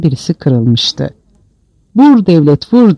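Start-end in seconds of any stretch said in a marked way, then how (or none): 2.0-3.0 raised, defiant cry; defiant shout. A narrator's reading. Beaten man daring the state to hit him, defiant shout. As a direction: dare the state to strike. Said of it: "diye bağırdı".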